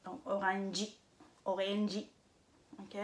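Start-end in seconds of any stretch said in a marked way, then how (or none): 0.3-0.9 orangey in separate beats, "o-ren-ji", ending on a clear ee; I final ajouté. Shown as a demonstration. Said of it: "ils le déforment en mettant un I". 1.5-2.1 orangey in separate beats, "o-ren-ji", ending on a clear ee; I final ajouté. Shown as a demonstration. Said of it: "ils le déforment en mettant un I".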